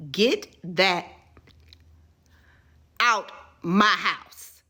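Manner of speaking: loud, slow furious command; grandma's angry order. Retold or imitated. Grandma ordering the boyfriend out, loud and furious. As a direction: bark it at the boyfriend. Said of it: "let me tell you exactly what she said".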